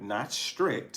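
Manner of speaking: ordinary talk, nothing marked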